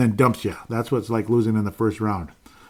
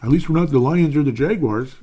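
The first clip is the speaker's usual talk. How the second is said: mock fan excuse; 4 semitones higher, 6 dB louder than usual. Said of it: "and say".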